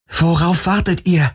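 high, impatient urging; urgent prodding. Acted. Heroine to hesitating guards, urgent and high.

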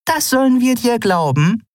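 high, scornful question; incredulous woman's voice; doubting Nelen. Acted. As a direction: cast doubt on him.

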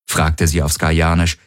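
plain reading voice

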